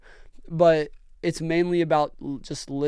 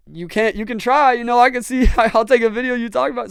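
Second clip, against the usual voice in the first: louder, higher and eager, with a laugh; excited; 7 semitones higher, 6 dB louder than usual. Retold or imitated. Offering the grieving dad a video, excited. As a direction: jump at the chance to film him. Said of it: "And I was like".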